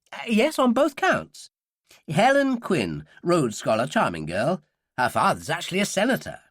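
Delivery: high-pitched, fussy character voice; eager professor, pitch lifted; uneasy academic. A narrator's reading.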